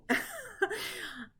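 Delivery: short, high, self-conscious laugh; a bashful chuckle at yourself; embarrassed laugh. Real, not acted. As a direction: laugh off the apology. Laughs at own bad accent.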